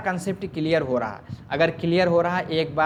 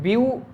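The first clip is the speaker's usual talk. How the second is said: "View" on its own, raised in pitch; isolated syllable; 6 semitones higher, 3 dB louder than usual. Shown as a demonstration. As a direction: sound out "view" as one separate, higher syllable. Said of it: "एक syllable".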